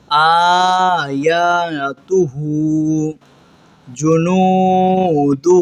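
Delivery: very slow drawn-out recitation. Shown as a demonstration. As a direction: stretch every syllable like a tajweed teacher. Teacher recites practice words slowly. (آہستہ کھینچ کر تلاوت)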